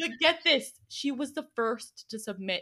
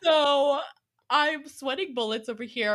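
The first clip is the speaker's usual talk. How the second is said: ordinary talk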